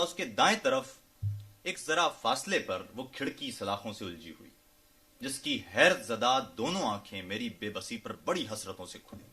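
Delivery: dramatic free-verse nazm reading; poetry recital. Acted. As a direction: lift each opening phrase, then let it fall.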